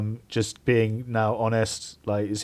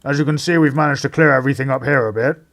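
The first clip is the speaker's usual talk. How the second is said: brisk, raised official voice, a bit higher; inspector's voice; 3 semitones higher, 5 dB louder than usual. Acted. Inspector briefing the players at the crime scene, brisk and official. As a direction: play the eager police inspector. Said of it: "Inspector Drummond steps forward and says".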